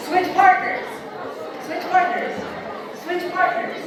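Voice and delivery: loud, high repeated call. Real, not acted. Teacher sending the group to new partners, calling out. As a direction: project over a busy room.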